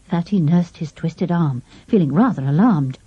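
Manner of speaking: ordinary delivery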